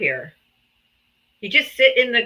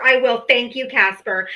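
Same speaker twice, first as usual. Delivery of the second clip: ordinary talk, nothing marked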